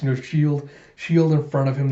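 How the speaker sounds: ordinary delivery, nothing marked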